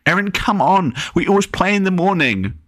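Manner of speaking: put-on a little boy pestering his sister; whining little brother. A narrator's reading. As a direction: whine like a bored little kid. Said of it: "The whining he made".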